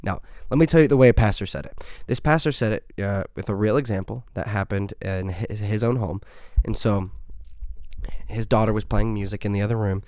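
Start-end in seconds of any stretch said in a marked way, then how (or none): none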